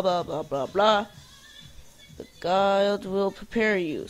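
ordinary delivery

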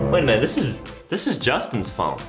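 ordinary talk

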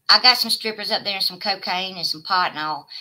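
ordinary talk, nothing marked

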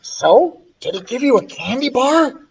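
high, eager half-whisper; excited whisper. Acted. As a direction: ask your friend eagerly, hushed and high. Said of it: "I whispered excitedly".

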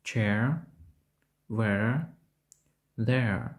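"Chair", "where", "there" with E gliding into a neutral vowel; дифтонг еа. Shown as a demonstration. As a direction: open on E, relax toward A in "Chair", "where", "there". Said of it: "подібного до Е або українського голосного Е". ("Chair", "where", "there" з переходом від Е до нейтрального звука)

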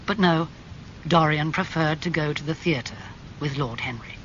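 plain reading voice

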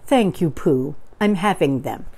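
plain reading voice

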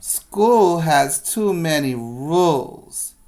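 put-on mild kid complaint. Ben grumbling to Jack and Emma, mildly fed up. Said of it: "Ben tells Jack and Emma".

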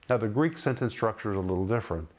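ordinary talk, nothing marked

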